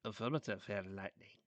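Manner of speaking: low, quiet, sly reply; devilish grin. A narrator's reading. Dark Claw answering the hurt hero, devilish and quiet. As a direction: gloat softly with an evil grin. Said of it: "said with a devilish grin".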